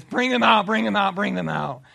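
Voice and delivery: a shaken king shouting for them to be freed; frantic shout. Acted. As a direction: yell it in panic. King panics at the furnace.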